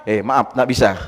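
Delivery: low, sheepish mock excuse; meek little apology, imitated; sheepish refusal. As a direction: imitate someone backing out, pitched well down. Acting the critic backing out.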